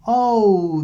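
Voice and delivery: plain reading voice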